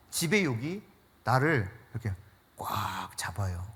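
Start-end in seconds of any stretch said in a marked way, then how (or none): 1.9-3.7 slow, hushed whisper; a slow secretive whisper for emphasis. Acted. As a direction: lean in and whisper it slowly. Preacher stresses grip of pride.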